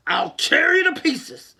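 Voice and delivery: loud slow threat. Acted. Angry lion threatens.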